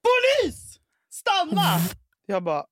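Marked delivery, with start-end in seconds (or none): 0.0-1.6 high, loud imitation of police yelling; hammed-up shout to halt; shouted police command. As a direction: yell it like a charging cop. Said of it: "springande och skriker".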